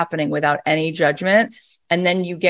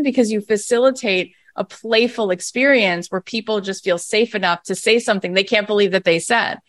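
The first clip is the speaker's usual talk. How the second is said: usual speaking voice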